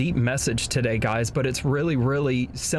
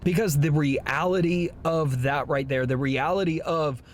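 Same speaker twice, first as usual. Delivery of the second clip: ordinary talk, nothing marked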